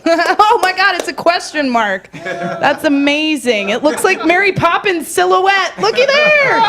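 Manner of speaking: high-pitched, gushing wonder; hammed-up awe building to a squeal; mock amazement. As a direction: marvel at it as if for a toddler. Acted adult marveling at child's achievement.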